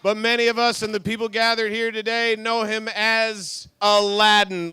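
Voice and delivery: loud, drawn-out hype announcement; announcer intro. Acted. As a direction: build up to the guest's name. Host introduces guest.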